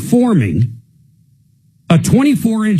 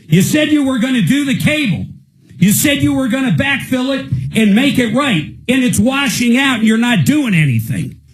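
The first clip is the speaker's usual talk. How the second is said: higher, accusing shout at the contractor; angry shout; 7 semitones higher than usual. Real, not acted. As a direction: throw every broken promise back at him. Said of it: "I'm probably going a little overboard".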